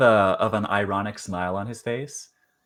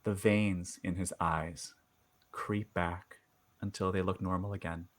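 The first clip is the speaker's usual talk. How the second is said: game master narration; 3 semitones lower, 6 dB quieter than usual. Acted. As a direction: draw out each eerie detail quietly. Game master sets eerie scene.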